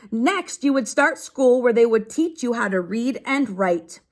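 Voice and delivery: steady read-aloud to a class; reading to children. Acted. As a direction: read the page out to the kids. Teacher reads a book aloud.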